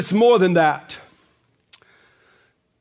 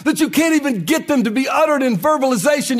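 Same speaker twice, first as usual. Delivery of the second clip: preaching at full force; 6 semitones higher than usual. Acted. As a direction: climb into a rousing sermon peak. Preacher builds to climax.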